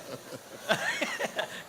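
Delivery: high burst of laughter; laughing. Real, not acted. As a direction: let out a big high laugh.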